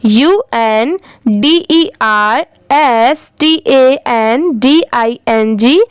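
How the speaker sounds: slow, letter by letter; spelling out letters. Shown as a demonstration. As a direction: spell each letter out slowly for students. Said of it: "आप भी मेरे बाद repeat करिए".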